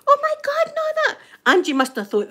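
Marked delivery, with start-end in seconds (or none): 0.1-1.2 high, hysterical retold wail; mock screaming. Housemate reacting to news of a death, mock hysterical screaming. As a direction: imitate a distraught housemate, pitched right up. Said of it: "she's screaming".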